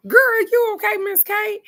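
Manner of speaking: very high and loud; excited call-out. Real, not acted. Calling to a viewer in the chat, high excited call-out. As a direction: call out to her teasingly.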